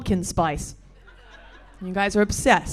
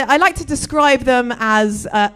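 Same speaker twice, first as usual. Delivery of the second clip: ordinary delivery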